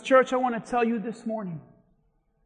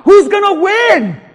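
high, loud preacher's shout; shouted challenge; 9 semitones higher, 13 dB louder than usual. Acted. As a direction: throw the question out at full volume. Same question repeated as a shout.

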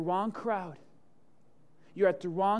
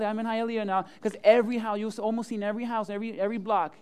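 ordinary talk

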